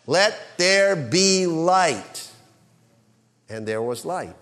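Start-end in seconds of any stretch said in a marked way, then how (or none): 0.1-2.3 slow, loud, high proclamation; creation command. Shown as a demonstration. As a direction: declare it like the voice of creation. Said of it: "as powerful and as effective as the ones spoken at creation".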